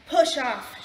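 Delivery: loud, rude storybook shout; sudden loud dismissal. A narrator's reading. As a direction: shout the wolf away. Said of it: "shouted the pig".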